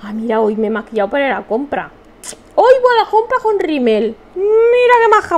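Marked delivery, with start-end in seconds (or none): 2.6-5.4 high, teasing whiny tone; mock whine. Real, not acted. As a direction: whine it jokingly. Jokey whine about mascara.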